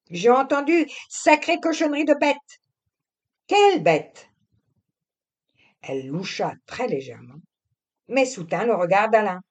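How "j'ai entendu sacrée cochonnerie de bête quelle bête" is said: high, loud young woman playing innocent; the wife dodging her husband's question; young wife, evasive. A narrator's reading. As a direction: feign ignorance, a little too quickly. Narrator voices Camille.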